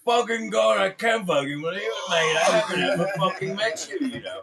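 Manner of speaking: loud, high British rock-star impression. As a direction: stammer and holler like a thrilled old rocker. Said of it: "Act like [name]".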